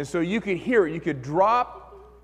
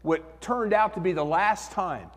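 usual speaking voice

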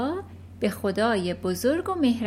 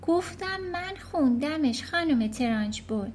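put-on higher, polite small-girl voice; little girl; 6 semitones higher than usual. Matilda answering the headmistress, polite and small.

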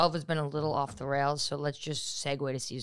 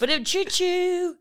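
high rimshot vocal effect; drum rim-shot noise; 5 dB louder, slower than usual. Pretend. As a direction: make a ba-da-choo rimshot sound. Joke sound effect.

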